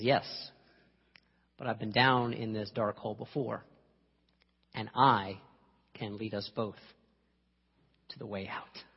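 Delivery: low, measured calm, softening to the end; calm reassurance. Acted. Friend who knows the hole comforts him, calm reassurance. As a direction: reassure gently, with long pauses.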